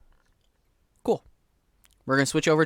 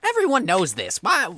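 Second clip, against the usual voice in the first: dismissive fisherman; 5 semitones higher than usual. Acted. Fisherman shooing player.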